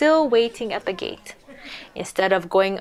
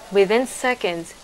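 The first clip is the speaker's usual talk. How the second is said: ordinary delivery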